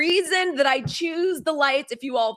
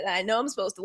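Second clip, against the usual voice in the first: normal talking voice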